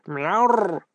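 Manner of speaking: growled cat cry; tiger-like growling meow. A narrator's reading. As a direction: meow like a snarling little tiger. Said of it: "рыча, словно тигр".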